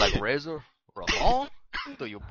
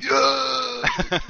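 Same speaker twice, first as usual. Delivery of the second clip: mock victory yell; 5 dB louder than usual. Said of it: "get on your knees and you're like".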